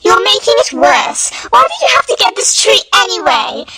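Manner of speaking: high, fast, exasperated scolding; exasperated Liz. Acted. Liz scolds Patty over the tree, exasperated. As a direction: scold her sister, fed up.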